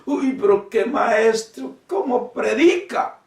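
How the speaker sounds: imitating a starstruck churchgoer; gushing churchgoer. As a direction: swoon over the preacher, hammed up. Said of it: "personas van a reuniones y dicen".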